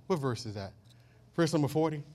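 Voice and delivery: normal talking voice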